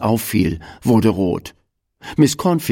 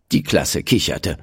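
ordinary delivery, nothing marked

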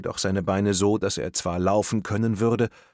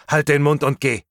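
louder, higher, cutting order; sharp command; 5 semitones higher, 6 dB louder than usual. Acted. Masked boy ordering his captive onward, sharp and loud. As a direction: shut the captive up forcefully.

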